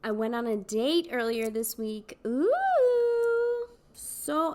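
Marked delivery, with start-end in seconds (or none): 2.2-4.5 high squealing ooh, loud and bright with glee; excited squeal. Real, not acted. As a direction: squeal with delight over the date. Gushing about a date.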